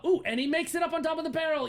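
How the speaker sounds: high, quick excited commentary; excited high-pitched rush; excited play-by-play. Acted. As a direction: cheer the climb, pitched high and fast. Game master cheers zombie's climb.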